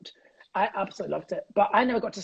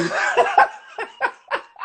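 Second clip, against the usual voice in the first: burst of laughter; 7 dB louder than usual. Real, not acted. Laughing at heckle story.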